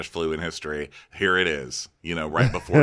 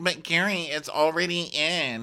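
coy Ashley; 10 semitones higher than usual. Retold or imitated. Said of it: "And she's like".

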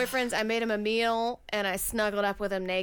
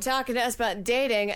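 ordinary talk

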